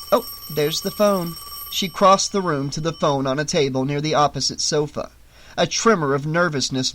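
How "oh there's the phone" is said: usual speaking voice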